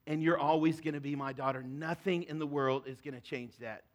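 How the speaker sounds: soft, low, steady comfort; tender fatherly reassurance. Retold or imitated. Father to the daughter who messed up, gently reassuring. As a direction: gently promise unconditional love.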